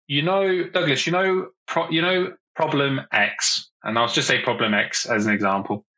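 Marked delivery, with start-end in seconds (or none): 0.1-3.7 sample elevator pitch. Acted. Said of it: "What if I said structure like this?".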